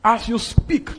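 high, loud, rising emphasis; forceful declaration. Acted. Preacher driving home the power of spoken words, forceful.

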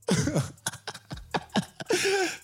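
high-pitched stuttering laugh; giggling fit before speaking. Real, not acted. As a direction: break into a high, drawn-out laugh. Laughs before ranting about Cash App.

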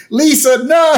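high, loud shouted warning; Marge shout. Retold or imitated. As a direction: shriek it at her. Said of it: "He's like".

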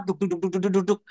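quick, soft thudding syllables; rapid heartbeat imitation. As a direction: mimic a racing heart under the breath. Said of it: "lebih, cepet banget". (tiruan detak jantung cepat, pelan)

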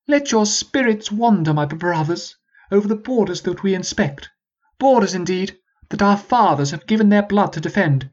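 lofty noble quoting poets; posh prince voice. Acted. Peabody's speech, posh voice.

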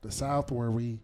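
low-key, soft Southern drawl; badly faked Southern accent, leaning on the Rs. Shown as a demonstration. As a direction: put on a quiet, deadpan Southern voice. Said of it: "I could do a Southern voice".

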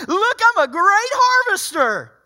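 high, loud mock-proud voice with a laugh; smug boaster. Preacher mocking a proud harvester to his church. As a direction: ham up the smug harvester who did nothing.

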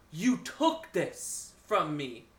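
slow, drawn-out mock accusation; menacing knife owner. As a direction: imitate a villain confronting a thief, very slowly.

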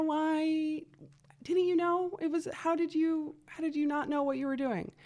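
high, incredulous questioning voice; questioning critic. Acted. Someone asking Allegra how she didn't know, incredulous. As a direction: put on a high voice, pressing for an explanation. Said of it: "and one would ask".

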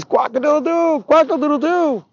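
very high cock-a-doodle-doo; rooster crowing. Acted. Boy showing God his rooster sound, high crowing. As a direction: crow like a rooster, voice way up. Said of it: "and roosters".

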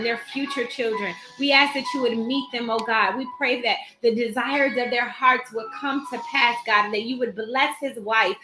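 ordinary talk

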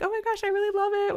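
the host imitating the fan's quick, high gushing. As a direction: rattle it off like an eager fangirl. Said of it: "She's like".

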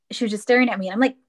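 ordinary talk

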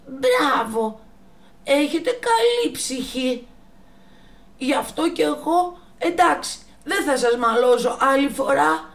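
high, loud moved giraffe voice; grateful giraffe. Acted. The giraffe thanking the monkeys, high and moved. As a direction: thank them with a full heart.

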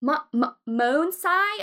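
stammered "Mo-, mo-", then "Monsai" as MOHN-sigh; botched name. Retold or imitated. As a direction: fumble into "Monsai" with a "sigh" ending. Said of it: "it's not how you say it".

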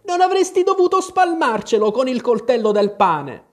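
high, loud scolding grumble; grumbling reproach. A narrator's reading. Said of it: "borbottò il cappellaio". (brontolio di rimprovero)

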